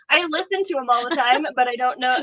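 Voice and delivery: high, loud, laughing self-defence; laughing protest. Real, not acted. Laughing protest, put on the spot.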